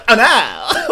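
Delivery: exaggerated "oh, no"; teasing imitation. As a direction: mimic a friend's catchphrase to tease. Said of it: "I'd be like".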